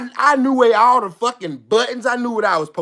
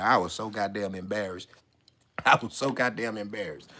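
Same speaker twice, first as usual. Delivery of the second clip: low, very soft admission; small, quiet, deep-pitched voice; embarrassed confession; 12 semitones lower, 11 dB quieter than usual. Retold or imitated. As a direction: confess it under your breath. Embarrassment confessed quietly, then louder.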